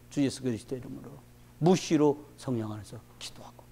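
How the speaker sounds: soft whispered prayer line. Acted. Pastor whispering the call to pray in the Spirit, hushed. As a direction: breathe it like a private prayer.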